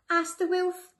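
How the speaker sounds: ordinary delivery, nothing marked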